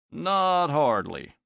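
slow, stretched drawl. Acted. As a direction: drag the words out lazily. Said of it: "drawled Mike".

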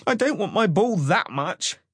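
put-on high, alarmed child character voice; panicky child. A narrator's reading. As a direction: blurt it high, ready to flee. Frightened child about to flee.

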